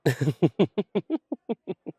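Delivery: a loud, bright burst of laughter; hearty laugh. Real, not acted. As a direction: laugh out loud at the customer's reply. Bartender laughs at regular.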